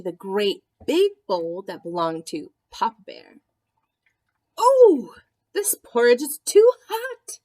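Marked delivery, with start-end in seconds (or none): 4.6-7.4 high, loud cry of alarm. Acted. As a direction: yelp it out, scalded. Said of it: "she cried".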